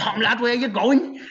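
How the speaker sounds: high, drawn-out mock Scouse; hammed-up Scouse squawk; Warhorse's over-the-top Scouse. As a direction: put on an exaggerated Scouse accent, high and slow. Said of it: "Scouse accent on".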